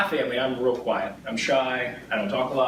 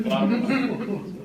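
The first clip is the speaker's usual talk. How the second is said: much higher, mock-cheerful repeat of "A lot of fun."; sarcastic "A lot of fun." pitched way up, forced brightness; sarcastic echo; 12 semitones higher than usual. Real, not acted. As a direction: squeak "A lot of fun." up high like you clearly didn't enjoy it. Repeats it, sarcastic after drinking tea.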